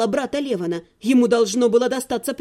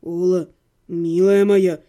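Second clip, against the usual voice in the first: low, slow and gentle; soft and tender; 7 semitones lower, 3 dB louder, slower than usual. A narrator's reading. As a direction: soothe her like a frightened animal. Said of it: "продолжал говорить мягким голосом".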